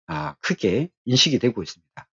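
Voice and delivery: normal talking voice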